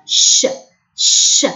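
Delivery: ش as a bare "sh", repeated; isolated sh sound. Shown as a demonstration. Teacher showing how ch is pronounced, isolated sh sound. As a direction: sound ش alone, like Arabic shin. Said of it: "ننطقه كالشين في العربية".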